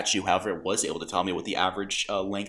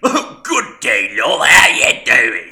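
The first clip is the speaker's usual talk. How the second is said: louder, slow, over-the-top British greeting; hammed-up British accent; 3 semitones higher, 14 dB louder, much slower than usual. Mocking Corvo's new voice to co-host, hammed-up British. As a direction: ham up a posh British gent, drawn out. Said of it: "Corvo sounds very different now".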